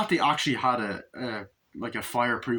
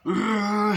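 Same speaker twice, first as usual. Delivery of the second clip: high, loud effort roar; attacking growl-yell; 10 semitones higher, 6 dB louder than usual. Retold or imitated. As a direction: roar while swinging a blow. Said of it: "and Chucky goes".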